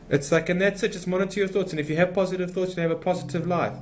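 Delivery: high-pitched imitation in a French accent; lightly hammed French-accented guru; mock French accent. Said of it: "with a French accent".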